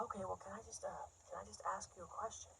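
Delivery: very soft, tentative guy voice; hesitate, then ask quickly and quietly; man from group. Acted. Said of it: "it's one of the guys".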